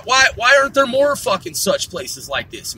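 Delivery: ordinary talk, nothing marked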